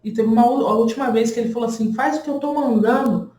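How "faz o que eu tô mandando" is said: imitating a rude doctor, a bit higher; rude doctor's order. Doctor giving a rude order to the woman in labor, mock curt. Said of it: "E ele super grosseiro".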